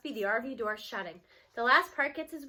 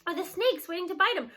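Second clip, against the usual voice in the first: usual speaking voice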